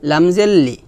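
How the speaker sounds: ordinary talk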